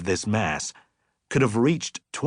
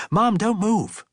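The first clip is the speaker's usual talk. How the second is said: higher, quick urgent plea; a boy telling his mom to hold still; urgent son; 11 semitones higher, 3 dB louder, slower than usual. Acted. Son asks mom to freeze so he can pluck her hair, urgent.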